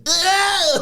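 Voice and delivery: Italian-American wiseguy yelp; mock Italian shout. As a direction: bark it like a mob uncle. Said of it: "and Nunzio says".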